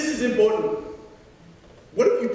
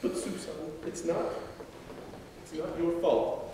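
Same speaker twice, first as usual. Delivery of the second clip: soft explaining; 5 semitones lower, 10 dB quieter than usual. Acted. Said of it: "Finland explains".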